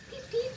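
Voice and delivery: high, soft chick peeps; high peeping. Shown as a demonstration. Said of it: "Some chicks say peep".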